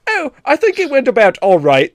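high, mock-polite English politeness; damn with faint praise, primly; hammed-up polite English critic; passive-aggressive English. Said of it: "masters of passive aggressive".

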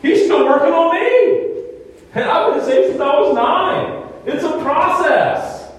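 fervent pulpit testimony; preaching. Acted. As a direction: lift it up and proclaim, slowing at the end. Preaching build, high and loud.

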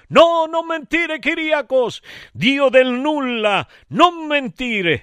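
high, drawn-out mock outcry; theatrical high-pitched protest; shouted in chorus. A narrator's reading. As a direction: cry out like a crowd of gods. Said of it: "gridarono in coro gli dei dell'Olimpo".

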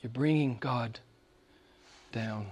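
repeated line dropped to a near whisper; fading hush. Acted. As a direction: let it die away.

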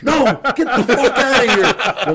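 loud, high, drawn-out yell; furious mock shout. Dad refuses the friend a ride, furious shout. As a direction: yell it slowly as an enraged dad.

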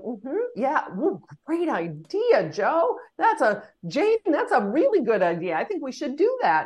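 high, sing-song fake agreement; mock people-pleaser gushing over a colleague's idea; cordial hypocrisy. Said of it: "the curse of cordial hypocrisy".

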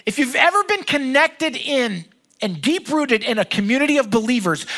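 ordinary talk

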